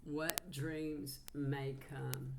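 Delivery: slow, deliberate phrasing; slow repetition. Real, not acted. Reader repeats Mary Magdalene's words to viewers, slow and deliberate. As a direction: let each word sit, slowly.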